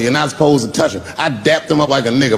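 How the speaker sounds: normal talking voice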